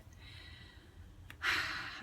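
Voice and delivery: long audible exhale; sigh. Real, not acted. Speaker resolving to just do it, long sigh. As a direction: let out a big breathy sigh.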